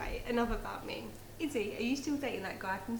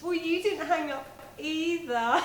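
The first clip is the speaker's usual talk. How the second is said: loud playful giggly accusation; giggly late night call; 6 semitones higher, 10 dB louder than usual. Acted. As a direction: laugh while catching him out. Caught not hanging up.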